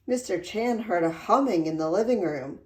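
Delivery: usual speaking voice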